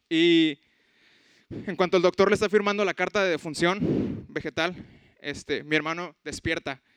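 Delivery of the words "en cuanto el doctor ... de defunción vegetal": usual speaking voice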